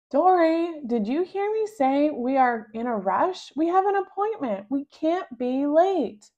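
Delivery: mom losing patience with her daughter; exasperated mom. Acted. Mom scolding rushed child.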